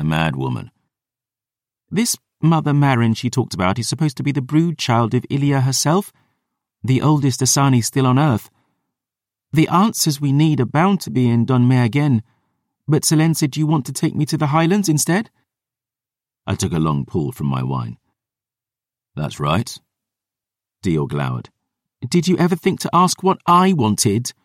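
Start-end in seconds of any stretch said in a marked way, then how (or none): 1.9-15.3 put-on higher, earnest young woman. Dior's girl voice. 22.1-24.3 put-on higher, hurt young woman; indignant girl voice. Dior's girl voice.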